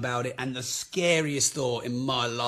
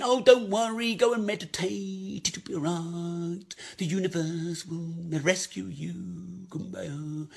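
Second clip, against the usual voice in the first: mock falsely positive; 3 semitones higher, slower than usual. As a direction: fade away into airy fake serenity. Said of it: "happy, clappy, falsely positive".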